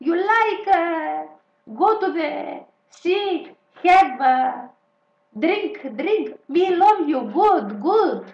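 loud, high broken-English pickup lines; hammed-up Greek beach flirt English. Mocking a Greek flirting with tourists in loud broken English. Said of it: "Γκρι καμάκι".

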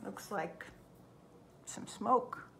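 a hushed whisper for suspense; breathy spooky whisper. Acted. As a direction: whisper it like a secret. Suspense while showing the picture.

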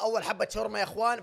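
ordinary talk

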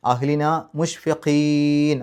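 മുഷ്ഫിഖീൻ with the ഖീ vowel drawn long, slow; stretched ending. Shown as a demonstration. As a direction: chant അഹ്ലിന മുഷ്ഫിഖീൻ slowly. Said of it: "തൊട്ട് മുമ്പുള്ള നീട്ടൽ".

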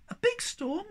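very high, squeaky anxious question. A narrator's reading. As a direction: ask in a small worried squeak. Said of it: "asked Sniff anxiously".

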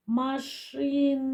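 slow word, lingering on the final sound; машина said slowly, sound by sound, ending on a long А; drawn-out last sound. Shown as a demonstration. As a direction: stretch машина out, holding the last А. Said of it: "І останній звук".

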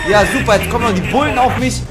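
normal talking voice

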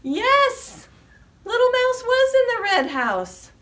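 high, loud triumphant shout; excited cheer. Acted. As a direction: burst out with delight for the kids. Mouse found under red house.

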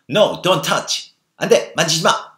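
loud, high scolding "no"; sharp forbidding. Acted. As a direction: snap it like a mother stopping a child. Mother forbidding her baby.

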